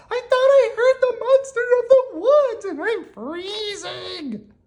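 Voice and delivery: tiny mouse voice, extremely high, drawing out the end; squeaky Mouse calling. A narrator's reading. Mouse calls to Owl from the freezing storm, squeaky high cry. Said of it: "called Mouse".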